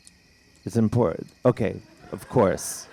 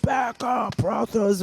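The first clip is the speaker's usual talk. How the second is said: imitating a shout; 12 semitones higher than usual. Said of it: "he's like".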